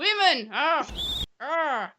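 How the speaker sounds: high hammed-up Irish word; mock Irish accent. Quoting Father Ted, high mock Irish accent. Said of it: "and they say".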